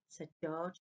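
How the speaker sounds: ordinary delivery, nothing marked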